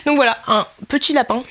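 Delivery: ordinary talk, nothing marked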